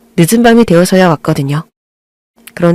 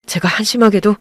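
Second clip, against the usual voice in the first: resentful son. Acted. Husband confronting his mother over years of neglect, bitter voice. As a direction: let the old hurt leak through.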